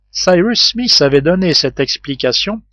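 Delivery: ordinary delivery, nothing marked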